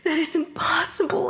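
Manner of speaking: high, breathless whisper; stunned whisper of disbelief; shocked whisper. Acted. Ghost in shock at the news.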